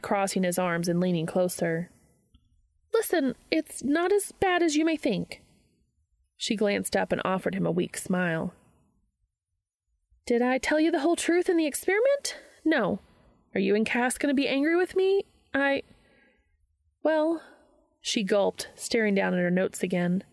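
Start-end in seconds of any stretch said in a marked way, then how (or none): 2.9-5.4 high-pitched, placating character voice; uneasy placating. A narrator's reading. Charlie placates Dean with a weak smile, high-pitched. 10.3-17.4 high, rising, faltering confession; guilty hedging. A narrator's reading. Charlie owns up to Dean and gulps, high guilty hedging.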